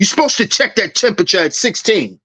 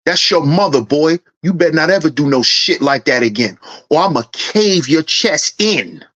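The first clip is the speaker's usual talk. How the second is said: a low stern warning dad; 7 semitones lower than usual. Pretend. Dad threatening son.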